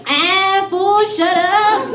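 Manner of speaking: high, slow, drawled Chicano street voice. Retold or imitated. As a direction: slide the words out like a homeboy at a Dodger game. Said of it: "all I have to do is be like".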